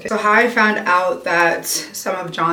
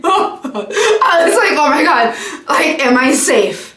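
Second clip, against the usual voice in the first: much higher and louder, hammed-up panic; mock alarm; 8 semitones higher, 4 dB louder than usual. On a boat with a Republican, hammed-up mock panic. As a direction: play up the fake terror, laughing at yourself.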